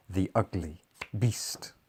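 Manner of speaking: ordinary delivery, nothing marked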